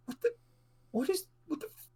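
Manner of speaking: high stammering shock; stuttery confusion. Acted. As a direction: stammer it out, baffled. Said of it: "They're very sort of stuttery".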